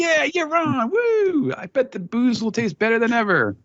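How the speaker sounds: high, whooping group cheer; hammed-up celebratory anime cheer; cheering crew. Host acts out the gang jumping in the air, cheering. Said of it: "they're all jumping in the air like".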